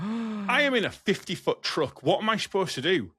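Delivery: high-pitched, indignant rant; exasperated protest. Retold or imitated. Truck driver protesting at a drive-through, exasperated. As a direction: plead your case in high-pitched disbelief.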